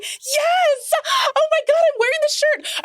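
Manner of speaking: overjoyed fan cry; squealing delight. Retold or imitated. Retold self, overjoyed.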